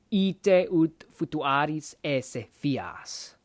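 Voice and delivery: ite ut futuaris esse fias slowly, word by word; slow careful read. Shown as a demonstration. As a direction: lay each word out slowly. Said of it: "careful full form".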